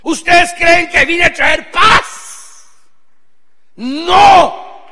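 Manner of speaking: high, loud, slow challenge; Jesus challenging disciples. Retold or imitated. Jesus questioning his frightened disciples, loud and high. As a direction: demand it of them like a prophet. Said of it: "les digo".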